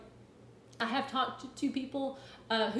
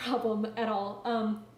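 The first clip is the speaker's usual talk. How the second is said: ice in mouth. Real, not acted. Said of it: "Sorry, got some ice in my mouth".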